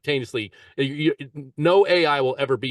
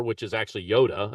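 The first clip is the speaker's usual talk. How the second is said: ordinary delivery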